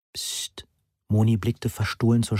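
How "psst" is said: soft, voiceless shush; hushing. A narrator's reading. Moni shushes the boy near the bedroom door, hushing. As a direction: hush someone sharply under the breath.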